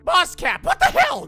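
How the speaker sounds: very high, incredulous yelp; comic outraged squawk; exasperated outburst. Acted. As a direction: demand answers, voice leaping up. Confronts boss over broken bots.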